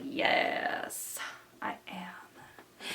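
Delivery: hushed regal claim; quiet mock-regal "yes, I am"; regal whisper. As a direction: whisper it like a proud queen. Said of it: "regal energy that's like".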